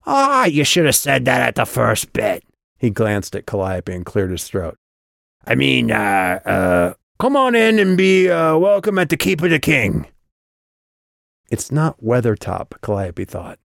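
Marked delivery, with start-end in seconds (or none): 0.1-2.4 high, scornful goblin voice; snorting goblin. A narrator's reading. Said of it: "The goblin snorted". 5.4-10.1 halting, then high loud goblin herald; fumble, then proclaim it like a goblin doorman; goblin formal welcome. A narrator's reading.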